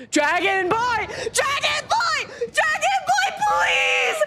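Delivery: rising from shout to high desperate scream; desperate pleading shout. Real, not acted. Begging the gacha for the character.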